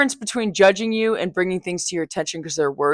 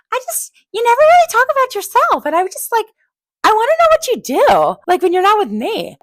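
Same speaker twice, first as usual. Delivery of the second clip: high, put-on voice for her best friend's curious questions; imitating her friend; 6 dB louder, faster than usual. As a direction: playfully mimic the friend. Friend quoted asking about her life.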